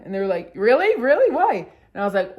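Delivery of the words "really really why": loud, high rising surprise; incredulous. Retold or imitated. Friends texting back about being high, incredulous. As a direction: fire off high, baffled questions.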